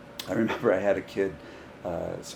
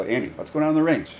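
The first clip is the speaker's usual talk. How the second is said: coach's raised summons; retold calling out; 8 semitones higher, 6 dB louder than usual. Coach summoning a new kid to prove himself, calling out. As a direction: call the player over, higher and louder.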